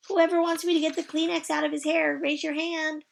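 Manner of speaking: put-on high, bright child's greeting; chirpy little girl. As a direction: sing it out like a cheerful kindergartner. Junie B. character voice.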